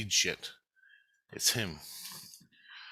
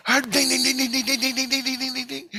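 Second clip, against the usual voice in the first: rapid high-pitched "ding" over and over; imitated desk bell; 4 dB louder than usual. Storyteller hammering the lab's desk bell, imitated dinging. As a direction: mimic frantically pressing a desk bell. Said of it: "I'm just pressing on it going".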